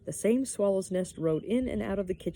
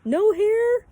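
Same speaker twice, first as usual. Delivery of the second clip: loud, high, drawn-out call; shrieking; 11 dB louder, much slower than usual. A narrator's reading. Pippi calls questions after the girl, shrieking. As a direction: yell the question after someone far away. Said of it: "shrieked Pippi after her".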